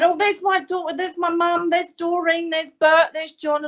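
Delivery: high, eager roll-call; imitated excited chatter. Spirits all talking at once to medium, high excited chatter. Excited audience recognising dead relatives.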